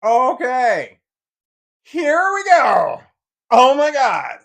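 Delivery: real relief, all-out shout; triumphant shout. Real, not acted. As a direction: bellow it in victory. Finally succeeds after long struggle.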